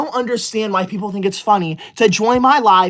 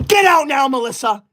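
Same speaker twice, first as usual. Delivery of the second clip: angry order. Real, not acted. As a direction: throw them out with a yell. Banning a viewer from live.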